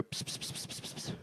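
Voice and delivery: unvoiced "pss" to lure a cat; cat-calling hiss. Shown as a demonstration. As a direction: call a cat with quick, soft psst sounds. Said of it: "second type where you go".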